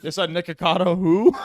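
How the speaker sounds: usual speaking voice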